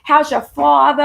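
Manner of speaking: imitating a strong Long Island accent; mock Long Island accent on coffee, talking, father. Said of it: "really strong Long Island accent".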